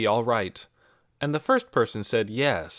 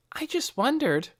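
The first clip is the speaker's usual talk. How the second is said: put-on much higher, hesitant girl; lightly voiced girl asking strangers; a curious girl asking strangers; girl's voice. A narrator's reading. Nikki replies.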